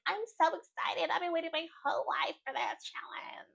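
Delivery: high, breathy mock excitement fading to a whisper; mock Kenzie. Vlogger mocking Kenzie's eager excitement, high and hushed. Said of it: "Kenzie's like".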